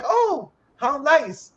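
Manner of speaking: high, drawn-out cooing reaction; mock polite delight. Group to a stranger in line, mock polite delight.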